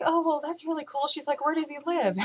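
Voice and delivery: high, impressed chatter; imitated eager friend. Friend quizzing her about the boyfriend, imitated high and eager. Mimics interested friend's reactions.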